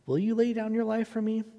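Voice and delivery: challenging question. Retold or imitated. Jesus' challenging question quoted.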